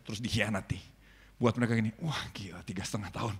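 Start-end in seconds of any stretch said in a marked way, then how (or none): 2.0-3.3 hushed, breathy marvel at a record; awed whisper. Retold or imitated. Villagers whispering in awe about the record. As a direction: whisper it like gossip you can't believe.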